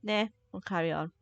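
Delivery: ordinary talk, nothing marked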